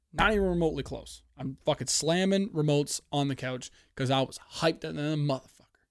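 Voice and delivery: normal talking voice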